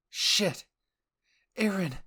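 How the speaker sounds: high, loud alarmed cry; shocked cry as Aaron is kicked into the wall; scream. Acted. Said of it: "you mentally screamed".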